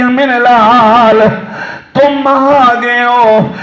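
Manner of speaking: a dying mother aching for her son, stretched slow; anguished dying mother. Acted. Dying mother greeting her returning son, slow anguished. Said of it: "ماں تڑپ کے کہتی ہے".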